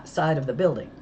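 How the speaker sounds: ordinary delivery, nothing marked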